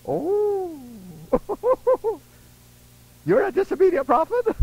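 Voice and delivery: high, incredulous question with a laugh; high incredulous captain; surprised captain. Retold or imitated. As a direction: disbelief breaking into a laugh.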